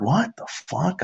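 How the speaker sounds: soft, breathy-free hush of disbelief under his breath; a quiet stunned curse. Retold or imitated. Muttered to himself beside the celebrity at the urinal, hushed.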